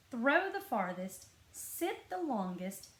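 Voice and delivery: plain reading voice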